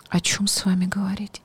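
soft disdainful whisper. Retold or imitated. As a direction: whisper it with scorn. Retold snobbish guest leaving.

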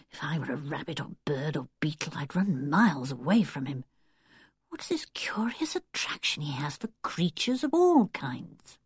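soft, low, half-whispered thought; private resentful musing. A narrator's reading. Anne alone puzzling over Wilfred and creatures, hushed resentment. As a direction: grumble inwardly, keeping it hushed.

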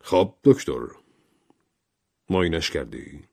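pointed, piercing question; a touch of a penetrating judge's tone. A narrator's reading. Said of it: "با صدایی نافذ پرسید".

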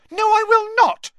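very high, loud refusal; furious yell. Acted. Ginny beside herself, refusing Ron, yelling. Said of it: "yelled Ginny, beside herself".

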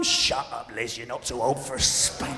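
low, gruff scolding voice, with a laugh in it; gruff old mother. Retold or imitated. Queen Mum snapping back at her daughter, low and gruff. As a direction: growl it down like a bossy old matriarch.

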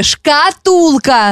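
very slow, over-articulated; slow exaggerated mouthing. Shown as a demonstration. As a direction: stretch every syllable for lip-reading. Mouthing the word for lip-reading.

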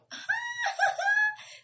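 high shrieking laugh that breaks out; loud burst of laughter. Real, not acted. Host cracking up at her own Scorpio joke, loud shrieking laughter. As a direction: cackle at your own joke.

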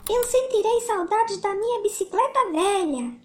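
a hint of sadness as the little bear; sad little bear. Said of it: "disse Tiago tristemente".

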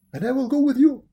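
very loud, high dog character. A narrator's reading. Dog offering to join Momotaro, loud high voice. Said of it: "says the dog".

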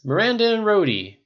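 loud, high proclamation; rousing toast. A narrator's reading. Tony toasting happy things, rousing shout. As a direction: raise the glass with your voice.

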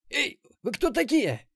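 high, loud protest; alarmed indignation. Acted. Captive marauder waking among interrogators, high indignant protest. Captured marauder wakes strapped down, threatens.

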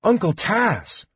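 high, drawn-out amazement; astonished gasp. A narrator's reading. Palin sees the kender appear, gasping in astonishment. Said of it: "Palin gasped in astonishment".

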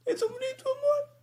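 very high, squeaky sobbing voice; hammed-up falsetto crying; mock tearful gush. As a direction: gush like you're about to cry over the couple. Mock tearful gushing over couple.

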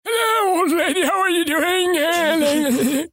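put-on high, slow creaky old man; quavering old geezer greeting. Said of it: "we've heard your old man voice".

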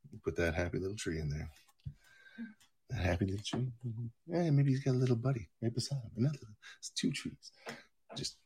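soft, hushed, soothing painting-show voice; Bob Ross. Retold or imitated. Painter adding happy little trees, soft and soothing. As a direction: murmur gently as you paint the scene. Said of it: "you put on some Bob Ross".

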